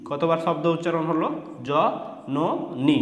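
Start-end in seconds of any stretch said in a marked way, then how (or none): 1.7-3.0 জ, ন, নি each said alone with a pause; separate syllables. Shown as a demonstration. Teacher showing learners how to split a word, জ, ন, নি said separately. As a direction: break it up as জ … ন … নি. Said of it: "আলাদা আলাদাভাবে বলতে হবে".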